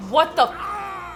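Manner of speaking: slow, high shocked yell; shocked shout. Real, not acted. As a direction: shriek in disbelief. Sudden shock in game.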